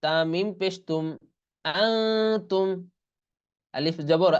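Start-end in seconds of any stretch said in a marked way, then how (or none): 1.6-2.7 slow, drawn-out Quran chant; Quranic recitation. Shown as a demonstration. Teacher recites the joined word for the students after spelling. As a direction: recite it slowly and sustain the vowels.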